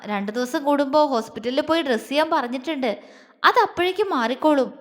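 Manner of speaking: ordinary delivery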